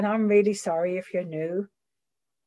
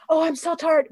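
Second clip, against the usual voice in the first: whiny sponsee; 7 semitones higher than usual. Retold or imitated.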